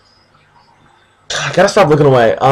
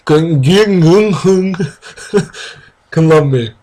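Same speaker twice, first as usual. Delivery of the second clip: higher, giggling reread of Ging Hun; laughing through it; 3 semitones higher than usual. Real, not acted. Rereading the garbled name while laughing. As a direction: fight back laughter on the name.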